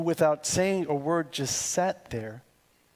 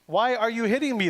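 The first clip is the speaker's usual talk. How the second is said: higher, louder, fast cry of the hurt donkey; a lightly voiced donkey crying out higher; 7 semitones higher, 4 dB louder, faster than usual. A narrator's reading. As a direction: let the donkey cry out, a bit higher and faster. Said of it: "the donkey cries out".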